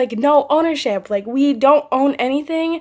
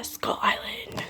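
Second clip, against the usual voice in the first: hushed, slow whisper of the title; spooky trailer whisper; 9 dB quieter, slower than usual. Pretend. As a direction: breathe the title like a movie trailer. Dramatic movie title aside.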